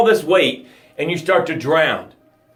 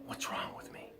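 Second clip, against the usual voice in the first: whispered, despairing question; 3 semitones higher, 22 dB quieter than usual. Pretend. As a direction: breathe it out hopelessly. Drowning person asks despairingly.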